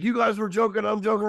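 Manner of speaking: high-pitched mock protest. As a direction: imitate a guy justifying his joke. Patient protests he was joking.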